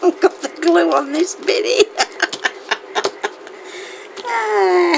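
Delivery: high, helpless laughter through the words; can't stop laughing while trying to talk; breathless giggles, near tears; giggling fit. Real, not acted. Giggle fit over glue.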